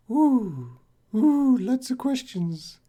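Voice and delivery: very high, drawn-out playful "ooh"; mock overwhelmed. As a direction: squeak it out, playfully swamped by questions. Playful overwhelm at many questions.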